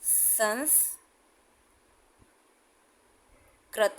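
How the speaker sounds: संस्कृत drawn out very slowly, syllable by syllable; slow demo word. Shown as a demonstration. Teacher sounding out संस्कृत slowly for students. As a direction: spell out संस्कृत slowly for the class. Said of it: "जैसे आपको शब्द दिया गया है".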